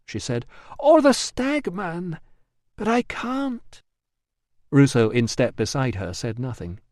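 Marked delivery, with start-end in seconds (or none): 0.8-3.8 high, slow resigned female voice. Acted. Emilia voiced.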